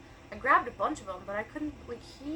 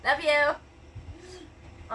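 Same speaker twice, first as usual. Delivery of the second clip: loud, high parting call; 7 semitones higher, 6 dB louder than usual. Real, not acted. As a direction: call it after someone leaving the house. Calling out to someone leaving.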